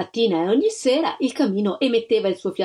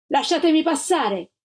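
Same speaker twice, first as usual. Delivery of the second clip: higher, defiant shout; defiant cry; 7 semitones higher than usual. A narrator's reading. The mother cries at the agents to let her pass, defiant. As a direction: demand they let her through. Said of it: "la Gilda gridò".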